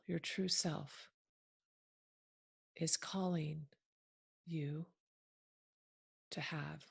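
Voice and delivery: measured phrases with long, settling pauses; unhurried meditation leader, softening as it goes; guided meditation. Acted. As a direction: ease listeners gently into the present moment. Leads listeners into a guided meditation.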